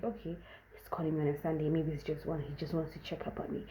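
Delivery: quick, hushed whisper to herself; whispered thought. Retold or imitated. Wondering to herself why he calls, in a fast whisper. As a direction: breathe the thought out, barely voiced. Inner thought on a Sunday call.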